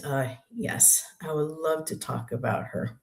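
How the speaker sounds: usual speaking voice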